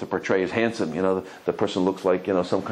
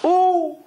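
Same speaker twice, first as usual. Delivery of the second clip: very high, loud hammed-up yelp; mock yelp; 5 dB louder than usual. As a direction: let out a high cry for dramatic effect. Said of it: "He yells".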